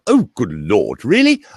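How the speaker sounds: plummy posh dinner-party exclamation; posh English accent. Acted. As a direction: slide into posh English dinner-guest talk. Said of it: "at a dinner party with some of them".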